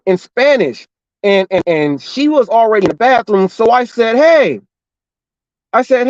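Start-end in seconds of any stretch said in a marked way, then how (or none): 4.2-4.6 sharp raised call for attention. Retold or imitated. Driver calling out to the restaurant worker, raised call. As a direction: call out to get his attention.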